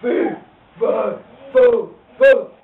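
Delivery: loud giant chant; giant roar. Acted. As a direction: boom it out loud. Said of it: "and he goes".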